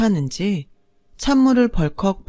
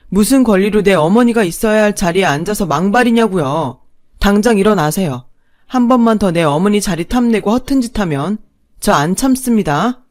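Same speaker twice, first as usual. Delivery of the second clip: loud, hard furious rebuke; 6 dB louder than usual. Acted. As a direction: stand up for your mother and order her out. Husband confronts stepmother angrily.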